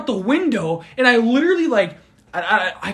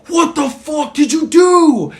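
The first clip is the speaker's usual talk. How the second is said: higher, louder and slow, dragged out; drawn-out angry demand; imitating a furious man; mock furious yell; 8 semitones higher, 5 dB louder, slower than usual. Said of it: "he's just like".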